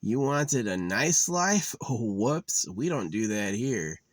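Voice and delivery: drawn-out mocking shrug; mock smug reply. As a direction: sneer it like a careless god. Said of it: "it's like".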